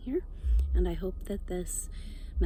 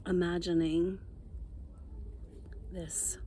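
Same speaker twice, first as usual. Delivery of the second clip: guided meditation. Real, not acted. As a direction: ease them down into calm, phrase by unhurried phrase.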